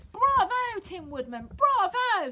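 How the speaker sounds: very high, loud shout of bravo; excited cheer. A narrator's reading. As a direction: cheer wildly for the hero. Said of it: "he was shouting".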